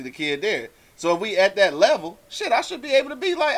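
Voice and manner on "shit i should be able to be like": ordinary talk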